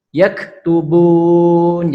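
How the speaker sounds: "Yaktubun" slow with a firm held k; pressed kaf. Shown as a demonstration. Teacher repeating "Yaktubun" so students hear the pressed kaf. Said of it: "harus ditekan".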